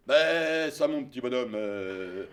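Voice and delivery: voix grave et posée de vieil homme; un vieux monsieur bonhomme, grave et lent; voix de Bertrand. Retold or imitated. Bertrand répond au jeune Belge. (imitation de Bertrand, voix grave et basse)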